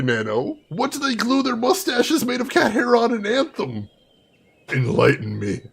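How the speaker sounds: usual speaking voice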